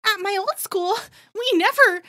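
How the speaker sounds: Phoebe catchphrase. Retold or imitated. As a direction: deliver it like a pet-peeve catchphrase. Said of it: "Her catchphrase is".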